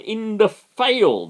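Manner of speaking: ordinary talk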